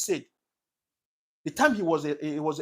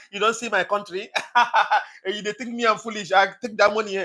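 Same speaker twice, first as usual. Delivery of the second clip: loud, high imitated gloating guffaws between phrases; mocking laughter; 5 semitones higher, 9 dB louder than usual. Politicians gathered in their clique mocking citizens, hammed-up laughing. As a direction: crow and cackle like politicians mocking the people. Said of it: "they will be laughing at you".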